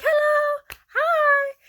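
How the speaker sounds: put-on very high, loud excited kid greeting; little girl's hello. Little girl greeting her again from the car, high and loud. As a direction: squeal hello like a delighted small child. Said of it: "she was like".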